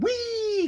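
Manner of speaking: gleeful squeal. Retold or imitated. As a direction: squeal like on a ride. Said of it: "just went".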